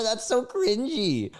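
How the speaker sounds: high, slow disgusted so cringy; so cringy squealed high; grossed out. Real, not acted. Pair with 166.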